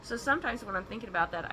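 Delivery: ordinary delivery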